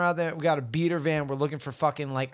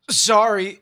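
loud retold apology; 7 semitones higher, 9 dB louder than usual. Crashing a stranger's party.

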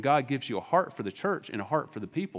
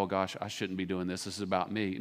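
softer, low self-focused fretting; quiet inward worry; 5 semitones lower, 5 dB quieter than usual. Retold or imitated. A sinner fretting to himself, much softer and lower. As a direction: mutter it to yourself, much softer. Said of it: "inwardly focused and go".

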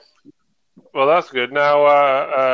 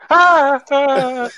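wife's scream; 8 semitones higher than usual. Retold or imitated. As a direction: scream like someone broke in. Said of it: "she screams like".

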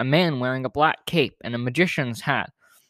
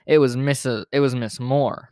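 usual speaking voice

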